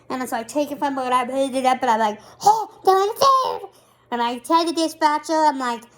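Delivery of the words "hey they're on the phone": high, loud burst of relief; suddenly high and loud, thrilled the call went through; excited relief. Real, not acted. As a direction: blurt it out, startled and thrilled.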